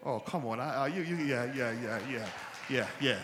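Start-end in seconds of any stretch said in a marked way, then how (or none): none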